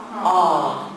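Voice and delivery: "Ah" as one long open vowel; drawn-out "Ah" with no r sound; doctor's-visit ah. Shown as a demonstration. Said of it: "like you're going to the doctor".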